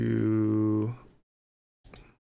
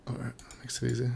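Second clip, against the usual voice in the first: fast whispered all right; whispered aside; 7 dB quieter, faster than usual. Real, not acted. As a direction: whisper it to yourself quickly. Muttered aside during hand.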